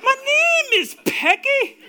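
hammed-up Scottish woman, very high and slow; Scottish accent. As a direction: squeal it in a drawn-out mock Scots accent. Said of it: "I'm not gonna do a Scottish accent".

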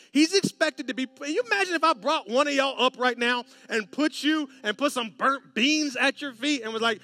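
loud, high, driving sermon build; pressing the church to feel his dread; preaching at full force. Acted. Pastor to congregation, loud building preaching.

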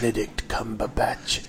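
Benedict Cumberbatch low, soft and mock-grand; affected name delivery. Podcaster savouring the actor's name, low soft mock-grand Benedict Cumberbatch. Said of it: "I have to say his name that way".

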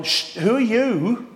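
high, drawn-out hushing challenge; dismissive brothers. Acted. Older brothers hushing David before the battle, dismissively. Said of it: "David's brothers have gone".

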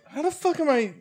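high, baffled mock rant; exasperated. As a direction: throw up your hands in disbelief. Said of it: "And I'm like".

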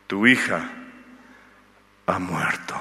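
low, slow bearer of bad news; grave messenger. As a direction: drop the voice low and heavy for the death news. Messenger brings news of death.

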